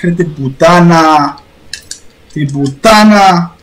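low, slow exasperated swearing; frustrated curse. Real, not acted. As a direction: drag the curse out in dismay. Crash, frustrated cursing.

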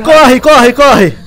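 high loud panicked urging; being chased. Real, not acted. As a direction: urge them to run in panic.

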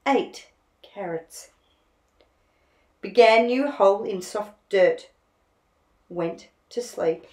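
put-on unhurried reading for children; storybook reading. A narrator's reading. Narrator reading a picture book to children, gently paced. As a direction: read a picture book aloud to little ones.

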